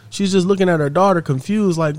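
ordinary delivery

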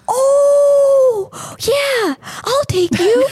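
high, mock-relieved deputy voice. Deputy finally understands, high relieved mock voice. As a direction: pitch it up, suddenly relieved. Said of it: "He's like".

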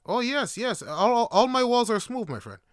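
lightly voiced hospitable friend; welcoming host. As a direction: act out a host welcoming a guest in. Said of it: "He's like".

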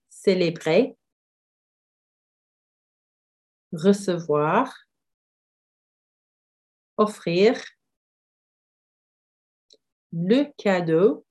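slow clear words with long gaps for repeating; listen and repeat drill. Shown as a demonstration. Teacher drilling vocabulary for students to repeat. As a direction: model each word slowly, leave space to echo. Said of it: "leave a pause so you can repeat them after me".

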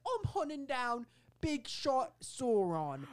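high, slow trailer declaration; mock-dramatic hero. Hero announcing the villain hunt, slow mock-dramatic. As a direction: stretch it out high and grandiose. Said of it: "he's like".

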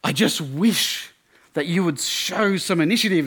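imitating an exasperated, angry girlfriend. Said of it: "really angry at him".

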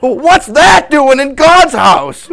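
high, loud, frightened protest; panicked priest. Acted. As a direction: babble in alarm. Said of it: "And he's like".

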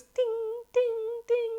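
high ringing "ding" sound effect; imitated clanging. Retelling a water bottle's fall, high imitated dings.

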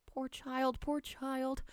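high, softened grandmother's soothing; tender consoling. Acted. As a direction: comfort a crying child. Grandmother consoles sobbing child.

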